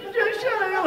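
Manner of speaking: very high, loud mock tantrum; hammed-up hysterical shriek; crazed self-scolding. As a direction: squeal at yourself as if losing it. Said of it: "I'm going crazy".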